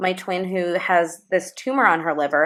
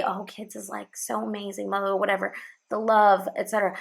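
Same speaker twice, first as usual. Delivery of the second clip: mock-gushing parent, murmured much softer; gushing cliché; 3 semitones higher, 5 dB quieter than usual. Parent repeating the cliché about kids, in a soft mock gush. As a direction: lightly parody the sugary cliché in a soft murmur. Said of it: "people will hear someone say".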